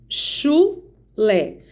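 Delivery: "chulé" split into chu and lé, with a clear pause between; teacher breaking "chulé" into its syllables. Shown as a demonstration. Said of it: "No chu".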